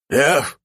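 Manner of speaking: panther yelp. A narrator's reading. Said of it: "Всё, что успела она издать".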